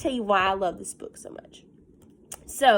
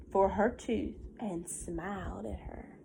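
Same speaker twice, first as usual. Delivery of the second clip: soft whispered read; 3 semitones lower, 11 dB quieter than usual. Real, not acted. Teacher reading the kind moment to children in a whisper.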